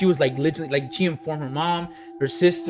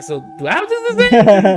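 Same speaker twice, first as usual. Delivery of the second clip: higher, louder mock-alarmed question; retold creeped-out worry; 7 semitones higher, 7 dB louder than usual. Retelling own reaction to the creepy call, mock-alarmed. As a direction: play up the worried asking, half joking. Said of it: "it creeped me out".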